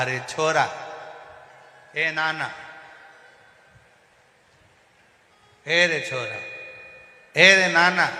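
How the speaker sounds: put-on calling the boys from across the house; loud call to the grandsons. Deaf grandpa calls his grandsons, loud call.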